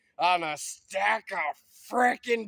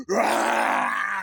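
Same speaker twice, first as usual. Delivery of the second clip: mock battle cry; 6 dB louder than usual. Mock Armageddon scream.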